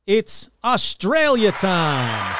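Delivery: put-on drawn-out Australian accent, slow and loud; hammy Aussie announcer, stretching Australia; bad Australian accent. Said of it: "my bad Australian accent".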